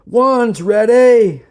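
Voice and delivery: drawn-out, high military shout; shouted call. A narrator's reading. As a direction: bellow it slowly like a drill sergeant. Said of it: "Sergeant Sampson shouted".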